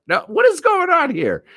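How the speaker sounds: hammed-up scandalized question; mock indignant. Catching co-hosts squabbling, hammed-up scandalized outrage.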